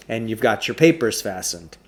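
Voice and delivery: ordinary delivery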